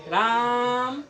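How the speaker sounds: राम called out with आ held long and laughing; drawn-out call. Shown as a demonstration. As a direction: stretch the आ in राम like calling someone far off. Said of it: "ऐसे करके बोलेगा ना". (राम का आ लंबा खींचकर हँसते हुए पुकारना)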